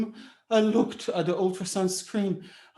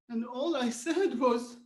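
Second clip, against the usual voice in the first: usual speaking voice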